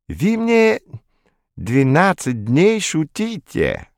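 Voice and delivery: high, slow, odd stresses; French accent with wrong stresses; random word stress. Acted. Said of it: "делая произвольное ударение".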